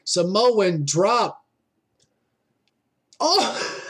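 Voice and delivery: lively live play-by-play calling; wrestling commentator. Real, not acted. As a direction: call the match as it happens.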